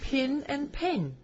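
co-host teasing the New Zealand vowel; mock New Zealand accent. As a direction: draw out Pin and pin in a jokey New Zealand accent. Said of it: "Ask him to say pin and pin".

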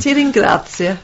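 put-on Italian, doubtfully authentic; jokey attempt at an Italian accent; mock Italian accent. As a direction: repeat it in playful Italian. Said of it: "it sounded more Egyptian".

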